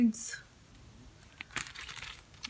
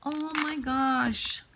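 ordinary talk

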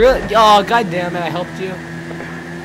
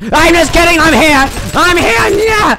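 loud, high taunt; teasing shout; 7 semitones higher, 5 dB louder than usual. Acted. Gamer taunting enemies loudly and high. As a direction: pop out and yell it.

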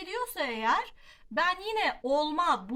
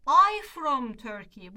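common mistake; 9 dB louder, much slower than usual. Shown as a demonstration. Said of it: "başka bir hata".

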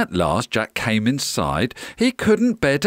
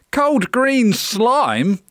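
put-on high, slow, disgusted child; groaning boy; 9 semitones higher, 3 dB louder, much slower than usual. A narrator's reading. Jack is offered slime by giant, high groan. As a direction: echo it in high-pitched dismay. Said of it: "groaned Jack".

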